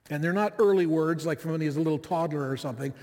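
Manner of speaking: normal talking voice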